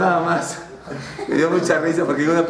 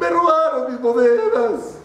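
mock weeping miser; 8 semitones higher, 3 dB louder, slower than usual. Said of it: "llega el avaro ... llorando".

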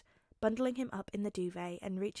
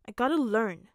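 put-on firm, louder little boy's insistence; stubborn; 9 dB louder than usual. A narrator's reading. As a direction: dig your heels in. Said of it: "Bakugo said stubbornly".

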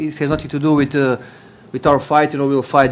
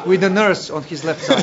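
punchline laughed out, higher pitch; giggling through his own punchline; laughing; 5 semitones higher than usual. Real, not acted.

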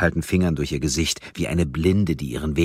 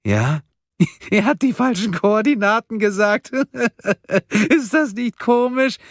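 laughing; 6 dB louder than usual. Acted. Karen keeps laughing at her joke.

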